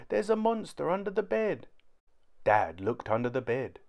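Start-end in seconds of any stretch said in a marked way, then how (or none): 0.1-1.7 sobbing. A narrator's reading. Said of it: "he sobbed".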